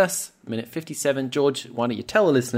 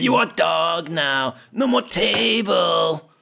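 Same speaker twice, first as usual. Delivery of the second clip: put-on slow, higher-pitched thick foreign accent, Arnold-like; Marco impression; 5 semitones higher, much slower than usual. Film fan mimicking the terrorist Marco, in a mock Austrian accent. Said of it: "he sounds like Arnold".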